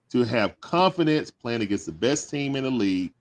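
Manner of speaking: ordinary talk, nothing marked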